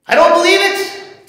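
loud, high, angry denial; acted outburst of flat-out disbelief; barked refusal. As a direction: bark it back at insistent friends. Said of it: "Thomas barks".

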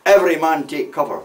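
retold warning sent down the line; urgent order. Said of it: "sent word down the road".

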